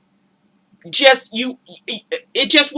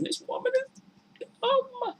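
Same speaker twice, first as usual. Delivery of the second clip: much higher, soft, slow disbelief; exasperated thought; 14 dB quieter, slower than usual. Real, not acted. Watching the show, exasperated at the woman. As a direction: cry out in quiet dismay. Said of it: "I'm thinking".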